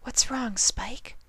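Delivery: high, whispered and gentle; soft question. A narrator's reading. Fluttershy gently asks the worried Spike, softly whispering. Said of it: "Fluttershy asked softly".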